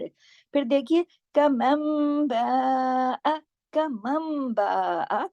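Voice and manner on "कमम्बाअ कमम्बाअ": कमम्बाअ very slow, the nun turned to mim before ba and the ba drawn out; tajweed recitation. Shown as a demonstration. Teacher demonstrating iqlab to students, slow chant. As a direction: stretch कमम्बाअ, nasal mim. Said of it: "नून साकिन के बाद बा".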